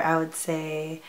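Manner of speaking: normal talking voice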